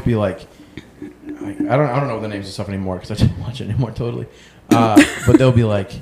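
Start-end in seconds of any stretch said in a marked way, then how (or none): none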